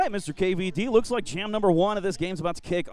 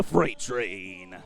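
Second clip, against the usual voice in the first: slow Texas-style drawn-out call; 8 semitones lower than usual. Retold or imitated. Announcing Freight Train the way Texans say it. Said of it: "That's how they say it in Texas".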